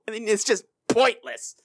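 normal talking voice

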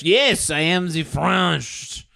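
hammed-up French accent; mock French accent. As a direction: declare it proudly in a bad French accent. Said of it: "we cannot place his accent".

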